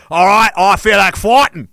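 put-on Aussie bravado; hammed-up Australian up for a scrap; Australian accent. Early settler spoiling for a fight, Australian accent. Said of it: "guys showed up and like".

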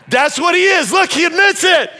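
very high, loud mock outrage; mock accusing shout. Preacher pointing out the pastor to the congregation, mock triumphant shout. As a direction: crow the accusation at full volume.

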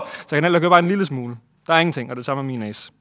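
ordinary talk, nothing marked

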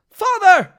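high, full shout; desperate call. Acted. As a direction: cry out across a battlefield to your father. Son calls to trapped father.

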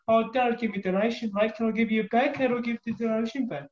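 put-on high, dodgy New Zealand accent; a bad New Zealand accent. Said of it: "dodgy New Zealand accent".

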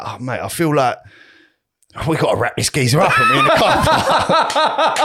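building from chatty to very high and laughing; laughing mimic of friend. Friend jokes about sorting out the golfer, rising laughing mimic. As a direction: squeal it out through laughter, slowing at the end. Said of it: "And he's like".

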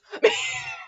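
piercing high squeal of laughter; shrieking laugh. Real, not acted. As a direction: crack up screaming.

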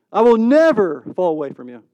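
loud, swelling stress on "never"; emphatic vow. Acted. Disciple repeating his vow to Jesus, emphatic. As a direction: punch "never" loudly, then ease off.

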